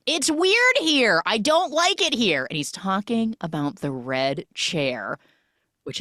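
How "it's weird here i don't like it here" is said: high, loud, slow whiny complaint; mock uneasy whine. Channing uneasy about the red chair, high and whiny. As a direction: whine like a spooked kid.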